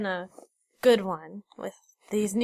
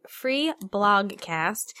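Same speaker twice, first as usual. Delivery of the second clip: ordinary delivery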